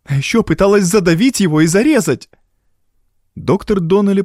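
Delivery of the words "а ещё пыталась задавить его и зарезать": high, agitated woman's protest; distressed exclamation. A narrator's reading. Evelyn adds the other charges, exclaiming high and upset. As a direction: pile on the accusation, pitch raised. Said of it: "воскликнула Эвелин".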